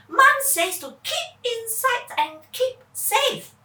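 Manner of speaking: cautious sister reminding rules; Charlene's voice. Acted. Sister cites mom's rule, high and earnest. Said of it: "Charlene said".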